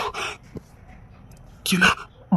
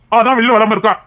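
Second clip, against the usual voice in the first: usual speaking voice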